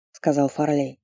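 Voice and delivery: usual speaking voice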